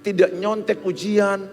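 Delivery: high, loud, fervent sermon; full-force preaching. Acted. Preacher exhorting students on honesty, loud and fervent. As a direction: preach it out, building.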